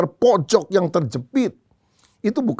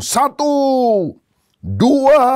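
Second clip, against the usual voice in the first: high, loud drawn-out counting; commanding count; 12 semitones higher, 5 dB louder, much slower than usual. Acted. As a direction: call out the count like a general. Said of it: "lalu dia mulai hitung". (hitungan lantang bernada tinggi)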